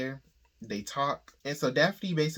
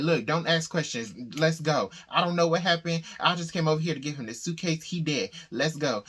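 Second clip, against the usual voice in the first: panicked; 3 semitones higher, 5 dB louder than usual. Retold or imitated. Daphne panicking at the murder scene.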